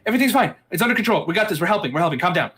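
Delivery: urgent calming. Acted. Player character calming the guards.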